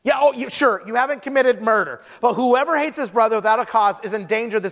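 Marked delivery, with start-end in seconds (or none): none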